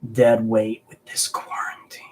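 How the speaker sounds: whispered aside to chat; whispering. Real, not acted. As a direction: breathe it out under your breath.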